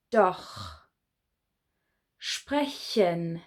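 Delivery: each German word said clearly and alone, pausing after each; model pronunciation. Shown as a demonstration. Teacher models German words for students to repeat. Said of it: "press play when you're ready to hear me say them".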